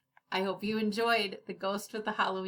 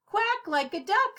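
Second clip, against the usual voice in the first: butcher; 10 semitones higher, 4 dB louder than usual. Acted. Butcher suggests.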